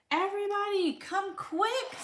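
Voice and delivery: high urgent call to everyone; excited call. Acted. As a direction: call them over in excitement. Arthur calls family to dog.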